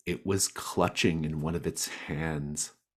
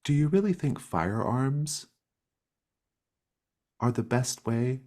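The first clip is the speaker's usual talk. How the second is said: much higher, prim disapproving creature; high concerned voice; 8 semitones higher, 6 dB louder, faster than usual. Acted. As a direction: ask it in a high, prissy tone. Gloam questions, voice jumps high.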